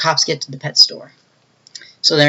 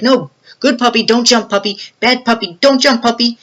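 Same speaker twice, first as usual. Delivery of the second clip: higher, coaxing plea to a dog; pleading with puppy; 7 semitones higher than usual. Retold or imitated. Henchman begs puppy not to leap, high coaxing. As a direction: coax the puppy not to jump.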